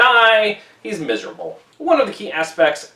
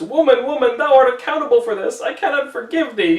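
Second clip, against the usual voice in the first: anguished accusation; 8 semitones higher than usual. Acted. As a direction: cry out the blame in anguish. Dimmesdale accuses Hester.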